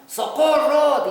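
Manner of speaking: loud, drawn-out cry for help; exclamation. Acted. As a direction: call out for help, high and slow. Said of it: "en signo de exclamación".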